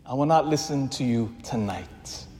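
low, hushed resolve; quiet refusal. Acted. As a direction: answer the voices softly and firmly, pitch dropped. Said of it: "we will say to those voices".

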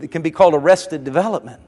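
ordinary talk, nothing marked

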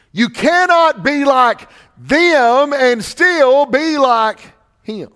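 slow, high, loud and drawn out; climactic declaration. Real, not acted. Preacher's climax about being like Him, slow loud proclamation. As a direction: let it ring as the climax.

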